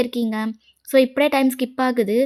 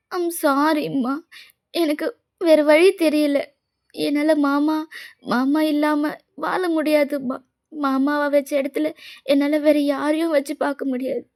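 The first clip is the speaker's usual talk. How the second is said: tearful pleading; 4 semitones higher than usual. Acted. Girl begging mother to understand her love, tearful pleading. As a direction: plead as if close to crying, pitch lifted. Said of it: "இதுக்கு மேல அழுது".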